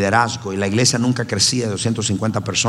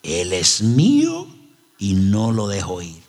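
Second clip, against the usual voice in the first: slow, menacing possessed voice, lightly done; a hint of a demon claiming its victim; demonic voice; 4 semitones lower, slower than usual. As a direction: drag the words out like something else speaking through him. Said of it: "me dice con una voz así".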